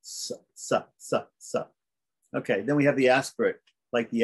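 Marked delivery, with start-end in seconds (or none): 0.0-1.7 softer, whispered sa sounds; voiceless sa repeated slowly; dental s demo. Shown as a demonstration. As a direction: hiss a clean dental sa with no voice. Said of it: "like the S in the word sweet".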